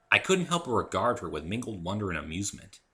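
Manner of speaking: usual speaking voice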